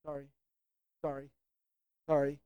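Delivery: soft, low, automatic little sorries; reflexive Midwestern apology. Acted. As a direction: mumble sorry on autopilot, over and over. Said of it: "do what a lot of Midwesterners".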